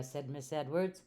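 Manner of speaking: ordinary delivery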